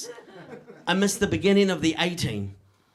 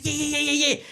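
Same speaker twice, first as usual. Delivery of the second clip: put-on high eager child yeah-yeahs; eager kid saying yes over and over; 7 semitones higher than usual. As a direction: pitch up like an excited little boy.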